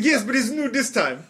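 high, eager mock-Israeli accent; pushy Israeli hawker impression; Israeli salesman pitch. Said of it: "he is not native to this country".

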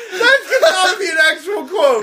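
cracking up mid-sentence; gasping the words out through laughter; laughing hard. Real, not acted. Friend reacting to an absurd podcast quote, laughing hard.

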